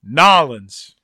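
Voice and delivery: Nolans said as one quick word; clipped local pronunciation. Shown as a demonstration. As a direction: squash New Orleans into Nolans. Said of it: "two correct pronunciations".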